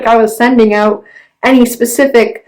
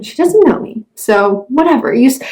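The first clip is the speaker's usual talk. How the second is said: ordinary talk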